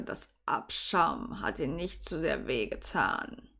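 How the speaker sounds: low, slow, hushed comforting voice; deep, quiet, tender man's voice; soothing Severus. Acted. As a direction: console softly in a deep, drawn-out murmur. Severus comforts the crying heroine.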